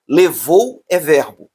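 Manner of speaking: "Levou é verbo", slow and spelled out; slow emphasis. Shown as a demonstration. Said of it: "teacher repeats key point".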